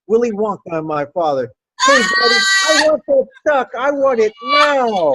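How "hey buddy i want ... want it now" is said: put-on whiny spoiled-kid shout, much higher and louder; bratty Veruca Salt demand. Child demanding that her father catch the duck, bratty shout. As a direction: demand it like a spoiled brat in a tantrum. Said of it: "I turned all Veruca Salt".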